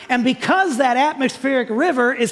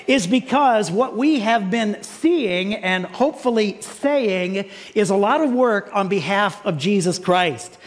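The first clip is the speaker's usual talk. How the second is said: normal talking voice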